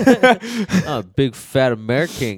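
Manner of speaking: laughing, exaggerated American impression; mock American accent. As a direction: ham up a foreigner's idea of an American. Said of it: "other countries' American accents".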